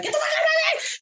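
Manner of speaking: very high-pitched screamed outburst; acted scream. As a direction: scream it high, like driving someone off. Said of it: "screaming in your face".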